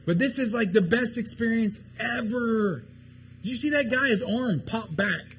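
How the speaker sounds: high, excited mock raving; amazed enthusiasm. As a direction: gush like an awestruck eyewitness. Disciples raving about miracles they saw.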